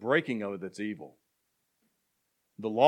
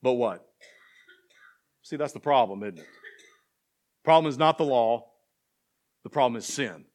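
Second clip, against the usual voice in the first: ordinary talk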